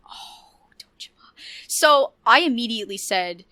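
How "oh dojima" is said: breathy hushed gasp of the name; awed whisper. Real, not acted. Fan whispering her favourite character's name in awe. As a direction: whisper it like a secret crush.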